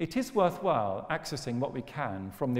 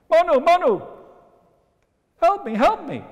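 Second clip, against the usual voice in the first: squeaky fish voice; 11 dB louder than usual. Acted. Fish cries for help.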